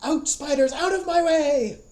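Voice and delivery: high, loud scream at the spiders; shrieking high command. Acted. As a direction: scream them out of the way.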